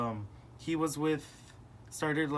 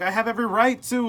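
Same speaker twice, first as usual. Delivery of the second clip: higher, louder and fast, retold shout; yelling back; 7 semitones higher, 9 dB louder, faster than usual. Son shouting back at his father, retold yell. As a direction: fire it back at him like in the fight.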